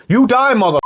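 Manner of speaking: high, punchy film-gangster threat; Al Pacino impression. Co-host retelling the kill scene, mimicked threat. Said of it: "He's like".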